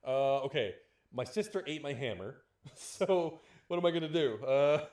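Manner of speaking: loud, panicky, laughing god voice; flustered Thor impression. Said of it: "He wouldn't have had to just like".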